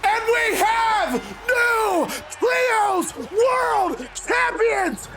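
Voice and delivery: high, drawn-out victory shout; triumphant announcer call. Real, not acted. Gamer announcing the new Trios champions, triumphant shout. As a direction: crown the champions like a ring announcer.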